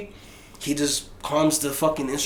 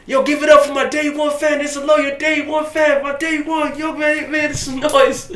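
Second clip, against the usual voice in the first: much higher, loud hype-man shout; stage shout; 11 semitones higher, 4 dB louder than usual. Retold or imitated. Rapper on stage hyping his day one fan, booming shout. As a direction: shout it to the whole arena.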